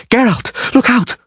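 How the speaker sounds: high, sharp warning cry. A narrator's reading. Sorceress shrieking a warning to Geralt, high urgent cry. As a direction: shout the alarm over falling rock.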